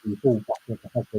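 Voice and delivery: muffled, garbled connection; underwater voice. Real, not acted. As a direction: sounds like talking underwater. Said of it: "You sound like you're underwater".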